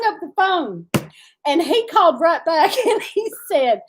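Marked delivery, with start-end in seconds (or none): none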